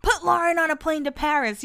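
half-yell it as an imagined outburst; mock yell. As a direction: pretend yelling at the boss. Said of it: "go yell at Lisa Love".

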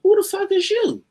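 high, loud incredulous yell; shouted loud as an offended star; mock outraged yell. A comic quoting the headliner's loud outraged yell. Collier barks at unrecognized comedian.

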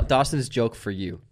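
ordinary talk

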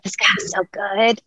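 a touch of gleeful anticipation; playful eager voice; mock excitement. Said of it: "you're like".

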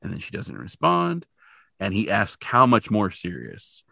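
ordinary talk

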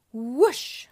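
loud whooshing rush of air; a quick loud whoosh as he dashes off; whoosh sound. Acted. Speed sound as Sonic leaves.

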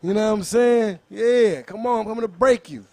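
high, loud battle-rap hype; hyped shouting. Pretend. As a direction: push it like a fired-up battle rapper. Hyped threats to rival.

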